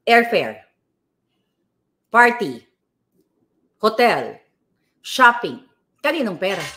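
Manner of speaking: each item called out loud; angry list. Real, not acted. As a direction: hurl each word like an accusation. Angry list of politicians' expenses. (pasigaw na paglista ng gastos)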